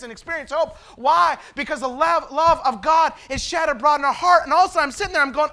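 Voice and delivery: loud, high, fervent preaching; full-force preaching. Acted. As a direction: preach it out with fire.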